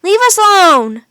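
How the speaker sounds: high, loud fierce shout; angry shout. A narrator's reading. Jack yelling at the two wolves, high and angry. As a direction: stand your ground and yell.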